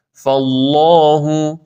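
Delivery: slow chanted فالله, thin ف and heavy ل; drawn-out فالله with dark long aa; model recitation. Shown as a demonstration. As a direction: stretch فالله as "fallaahu".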